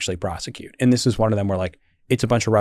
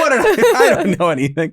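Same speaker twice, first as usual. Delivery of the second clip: higher, laughing helplessly; laughing exclamation; 12 semitones higher, 4 dB louder than usual. Retold or imitated. Telling the host about being naive, laughing. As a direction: laugh out the confession. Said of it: "I was like".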